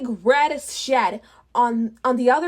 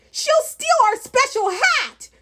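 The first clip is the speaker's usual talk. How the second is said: high, louder goblin yell; 12 semitones higher, 4 dB louder than usual. Acted. Goblin in the magic hat yells a warning, high and loud. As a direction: squeal it out in panic. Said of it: "yelled the goblin".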